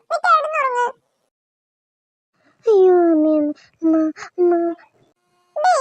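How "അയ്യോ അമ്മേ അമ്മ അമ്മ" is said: frightened cry. Acted. Sudden fright at night.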